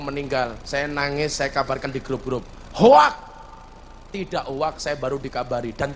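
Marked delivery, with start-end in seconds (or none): 2.7-3.1 loud, high one-word outburst; imitating a loud, disbelieving objection; retold accusation. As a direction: throw it out as a sharp accusation. Voices accusers replying to his post.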